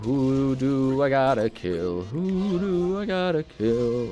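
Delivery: very slow, stretched words; slow drawl. Real, not acted. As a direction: drag every word out. Drawn-out game musing.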